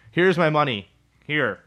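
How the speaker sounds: imitating someone shoving money at you; pushy customer.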